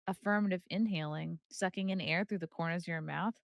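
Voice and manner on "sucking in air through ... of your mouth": normal talking voice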